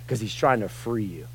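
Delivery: normal talking voice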